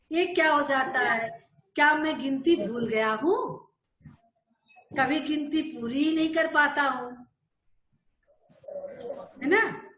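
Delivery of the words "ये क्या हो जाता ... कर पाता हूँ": ordinary delivery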